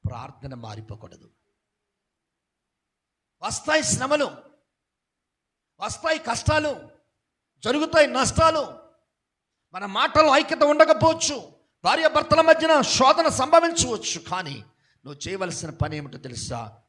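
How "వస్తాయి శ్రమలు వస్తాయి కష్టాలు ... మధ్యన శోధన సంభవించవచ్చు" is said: raised, driving sermon voice building up; preacher warning the church, pressing harder and louder; fervent preaching. Acted. Preacher at full force.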